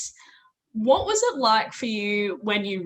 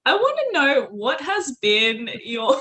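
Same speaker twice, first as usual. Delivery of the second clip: ordinary talk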